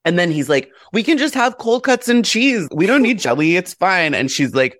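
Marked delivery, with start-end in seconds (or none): 0.9-4.1 high-pitched mock reassurance; lightly acted, breezy husband; dismissive husband. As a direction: play down the problem, pitched up. Husband brushing off the dinner problem.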